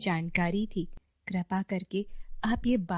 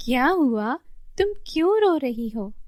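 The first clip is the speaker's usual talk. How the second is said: higher, louder worried question; concerned questioning; 5 semitones higher, 4 dB louder than usual. Acted. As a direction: ask a crying child what's wrong, voice lifted. Fairy asks crying child what's wrong.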